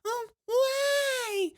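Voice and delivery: mock sobbing like a big baby; whiny baby. Mocking the host as a crybaby, very high whine. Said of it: "You sound like a baby".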